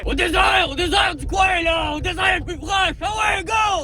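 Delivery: high-pitched insistent shouting. Acted. Prophet tells grandpa which desert, shouting. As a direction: holler as if the listener were deaf. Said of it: "c'est pas nécessaire de crier".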